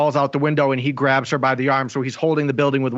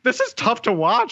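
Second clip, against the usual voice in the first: imitating mom, pitched up; mom quoted, higher; 9 semitones higher than usual. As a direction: do mom's dismayed remark in a higher voice. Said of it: "she turned to me and she's like".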